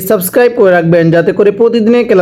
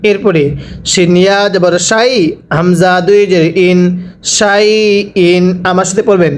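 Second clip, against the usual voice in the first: usual speaking voice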